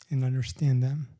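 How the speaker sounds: measured spoken-word poem delivery with long pauses; unhurried poet at a reading, every line weighed; poetry reading. Acted. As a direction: read each line like a poem, letting the pauses breathe. Reads poems aloud.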